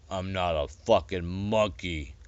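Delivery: lightly voiced slow growl; a hint of a menacing beast. A narrator's reading. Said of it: "He growled".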